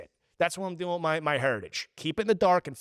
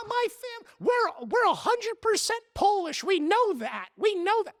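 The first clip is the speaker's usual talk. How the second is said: much higher mocking voice of a proud know-it-all; mock ancestry-proud voice; 12 semitones higher than usual. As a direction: squeak it out like someone bragging about their roots.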